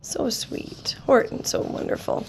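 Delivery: soft breathy whisper to the listener; whispered aside. Real, not acted. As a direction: lean in and whisper your delight. Reader's hushed aside.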